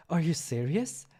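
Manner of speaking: high, loud disbelief; surprised question. Acted. Krille incredulous.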